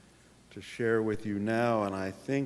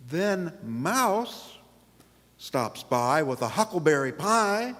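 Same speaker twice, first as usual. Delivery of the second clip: put-on lively read-aloud for children, pitched up; story narration; 9 semitones higher, 8 dB louder than usual. A narrator's reading. Pastor reading picture book to congregation, storytelling voice. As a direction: tell it bright and bouncy to the kids.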